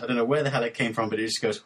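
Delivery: ordinary talk, nothing marked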